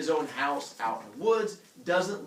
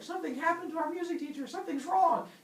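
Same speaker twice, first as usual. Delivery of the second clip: high, hurried cry of alarm; mock panic. Teller as Heracles alerting the town, high mock panic. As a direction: raise the alarm in a high, comic rush.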